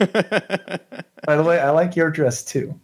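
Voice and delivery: acted friendly chirp; really chipper. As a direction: return the compliment brightly, in character. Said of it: "like, really chipper".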